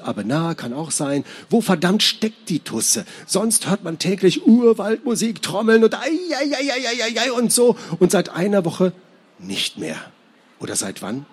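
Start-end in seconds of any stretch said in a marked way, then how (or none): 1.5-8.9 high-pitched exasperated inner rant; irritated rant. Acted. Character's irritated thoughts acted out. 9.4-11.3 sudden drop to low, soft musing; brooding doubt. Acted. Rant drops into brooding doubt.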